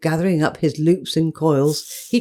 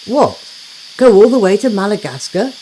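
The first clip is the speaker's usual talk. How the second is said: shocked cry; 3 semitones higher, 5 dB louder than usual. Acted. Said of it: "cried the housemites".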